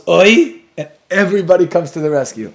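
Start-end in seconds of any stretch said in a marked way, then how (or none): 0.1-0.6 yell. Retold or imitated. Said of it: "one Yid yells".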